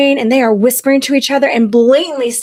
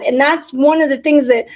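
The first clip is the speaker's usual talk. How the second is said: ordinary talk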